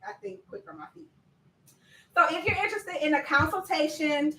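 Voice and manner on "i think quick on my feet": soft, fast whispered retake of "quick on my feet"; whispered aside. Real, not acted. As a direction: mutter it under your breath, quickly. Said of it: "Well, most of the time".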